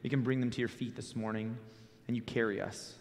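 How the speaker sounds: low, soft prayer. Real, not acted. Closes an opening prayer.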